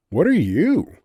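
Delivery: scoffing retort. A narrator's reading. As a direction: snap the question right back. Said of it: "Cynthia scoffed".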